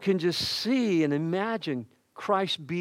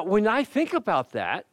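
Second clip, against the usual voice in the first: normal talking voice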